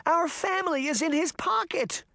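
put-on very high little-girl cry; high-pitched desperate warning; Daphne. A narrator's reading. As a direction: plead urgently in a small girl's high voice. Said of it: "Daphne cried".

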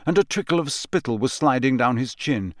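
plain reading voice

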